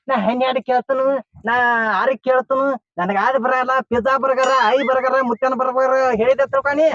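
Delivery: high-pitched worried mimicry; mimicking her answers. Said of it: "ನನಗ ಅದ ಚಿಂತೆ ಐತೈತಿ".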